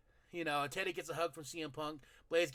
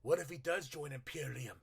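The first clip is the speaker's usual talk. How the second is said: excited speculation; 11 dB louder than usual. Real, not acted. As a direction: build from hushed to a laughing yell. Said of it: "imagining Imperium joining".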